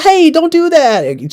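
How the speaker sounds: thin high whimper of a complaint; mock helpless plea. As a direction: play the ignored wife, drawn out and high. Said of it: "She's like".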